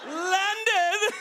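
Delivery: high, prissy "Landed?"; whiny spoiled prince. Pretend. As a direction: lilt it up like a pampered prince. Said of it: "You sound like a spoiled prince".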